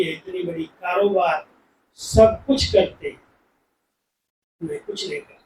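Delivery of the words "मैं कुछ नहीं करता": humble whisper. Retold or imitated.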